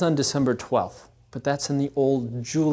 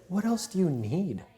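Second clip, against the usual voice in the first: tender question; 4 semitones higher, 7 dB quieter than usual. Retold or imitated. Mary's tender words quoted.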